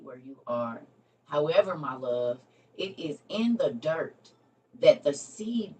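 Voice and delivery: heartfelt scripture reading to the viewers; devotional reading. Acted. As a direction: read God's love letter like a gentle sermon. Reads devotional love letter aloud.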